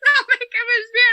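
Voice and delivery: high, loud tearful wail; mock sobbing. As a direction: sob the words out. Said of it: "I just start sobbing".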